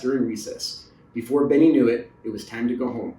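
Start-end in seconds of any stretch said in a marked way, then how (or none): none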